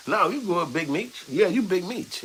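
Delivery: mock the dad with a high sing-song; teasing son. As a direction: son needling his father, high and teasing. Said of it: "you like".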